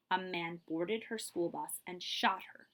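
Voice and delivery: ordinary delivery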